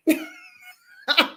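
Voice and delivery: high delighted laugh; high burst of laughter. Real, not acted. Laughs at own story.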